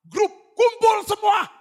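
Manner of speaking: high, slow, shouted order; calling out. Retold or imitated. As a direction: shout it out to the whole group. Pastor instructs gathering family. (teriakan keras memanggil semua)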